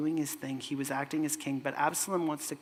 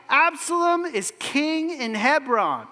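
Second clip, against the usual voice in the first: proclaiming shout; 9 semitones higher, 6 dB louder than usual. Retold or imitated.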